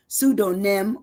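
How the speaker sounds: "pseudonym" in measured syllables, SOO-doh-nim; broken down. Shown as a demonstration. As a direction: give "pseudonym" a full "doh" in the middle. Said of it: "I break it down".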